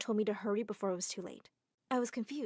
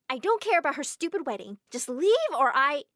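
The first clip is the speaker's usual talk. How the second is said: louder, higher angry outburst; angry refusal; 4 semitones higher, 7 dB louder than usual. Acted. Girl angrily refusing the maids before being dragged. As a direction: snap at the maids.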